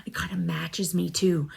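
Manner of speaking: softly whispered aside. Real, not acted. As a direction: breathe it out as a secret. Aside about soft blanket.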